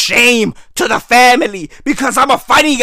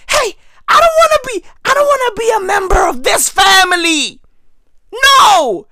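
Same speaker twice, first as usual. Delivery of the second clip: high, mock-outraged refusal; indignant protest; 6 semitones higher than usual. Retelling his revolt at grandma's funeral, mock indignant. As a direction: protest shrilly, then slam down the refusal.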